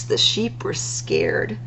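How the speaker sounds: usual speaking voice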